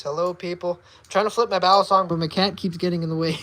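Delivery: ordinary talk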